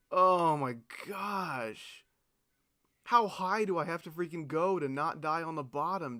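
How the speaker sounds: usual speaking voice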